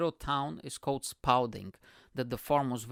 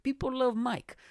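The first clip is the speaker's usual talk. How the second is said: tag only; 11 semitones higher than usual. Retold or imitated. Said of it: "And I was like".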